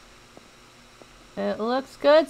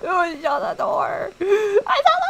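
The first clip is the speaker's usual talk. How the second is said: laugh-crying; 9 semitones higher than usual. Real, not acted. Laughing, sobbing at mistake.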